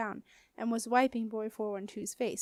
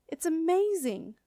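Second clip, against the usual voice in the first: high, slow cry of wonder; amazed; 7 semitones higher, 4 dB louder than usual. A narrator's reading. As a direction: marvel out loud, lifted high. Marcia sees boy floating.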